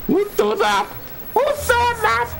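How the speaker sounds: high-pitched frightened stream of cries and pleas; real panic. Real, not acted. Scare in horror game, pleading.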